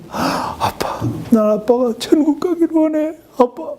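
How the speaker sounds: put-on high pleading child's voice, breaking; a little girl begging her dad through tears; crying little daughter. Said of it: "밤날 막 울으면서".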